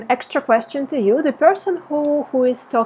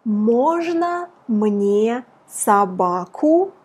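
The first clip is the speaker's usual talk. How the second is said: slow model phrase; much slower than usual. Shown as a demonstration. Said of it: "you want to say".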